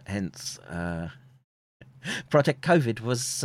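usual speaking voice

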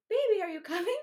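high, loud pleading question; hammed-up needy girlfriend plea; needy drunk text. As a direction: whine it up high, mock-clingy. Reading own needy texts.

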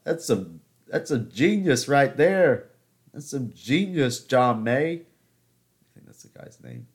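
normal talking voice

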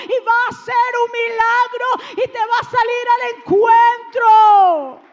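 high, loud preaching build ending in a shout; fiery sermon crescendo; preaching at full force. Acted. Preaching climax to congregation.

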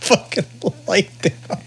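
real laughter, breathy and high, words squeezed out; laughing. Real, not acted. Telling the cop-car story to his friend while cracking up. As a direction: lose it laughing mid-word.

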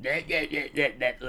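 dumbed-down mimicry of a Scottish accent; mock the accent with nonsense syllables; mocking imitation. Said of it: "pure dumb it down and be like".

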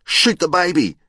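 put-on high-pitched child's shout; acted kid shouting an answer; excited calling out. A narrator's reading. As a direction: call it out eagerly, pitched high. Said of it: "One called out".

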